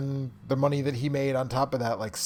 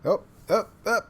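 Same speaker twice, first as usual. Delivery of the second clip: excited; 9 semitones higher, 6 dB louder than usual. Real, not acted. Stream countdown suddenly starts.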